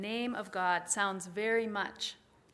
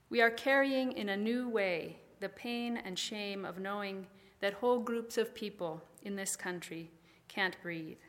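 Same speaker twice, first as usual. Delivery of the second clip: ordinary talk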